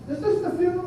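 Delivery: high, light voice asking if this is the funeral; a hint of a confused mourner; mourner's question.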